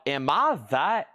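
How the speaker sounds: high, drawn-out incredulous question; freaked-out disbelief. Retold or imitated. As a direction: recount your own disbelief, stretching the words upward. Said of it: "I was so freaked out over this".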